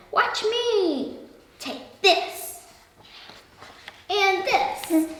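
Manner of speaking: a small mouse hamming up his bravery; mock battle shout. A narrator's reading. Mouse showing the lion how brave he is, mock shout.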